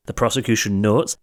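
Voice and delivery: usual speaking voice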